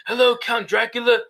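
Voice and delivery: formal greeting in a shaky attempt at British; Keanu Reeves. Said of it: "see him struggle not to say "dude".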